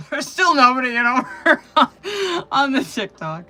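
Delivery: laughing through the words. Real, not acted. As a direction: laugh while saying it. Laughing mid-sentence.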